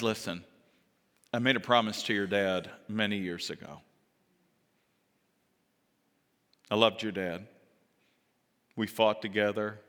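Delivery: low, soft and heartfelt; tender reassurance. Acted. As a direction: speak gently, like an old family friend. David tenderly reassures Mephibosheth.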